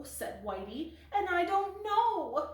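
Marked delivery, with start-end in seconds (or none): 1.1-2.5 much higher, louder small kitten voice; youngest kitten. Acted. Spotty echoing her sisters, high and squeaky. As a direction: pipe up like the littlest kitten.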